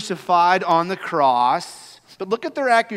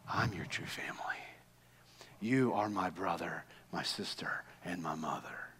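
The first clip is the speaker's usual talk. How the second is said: quoting Jesus in a soft whisper; whispered quote; 9 semitones lower, 14 dB quieter than usual. Acted. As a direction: whisper Jesus' words tenderly. Jesus' words quoted hushed.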